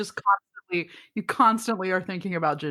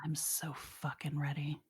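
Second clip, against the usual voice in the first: breathless whisper, much softer; whispered excitement; 5 semitones lower, 16 dB quieter than usual. Pretend. Host answering are you ready for it, whispered. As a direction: hiss it like a secret thrill.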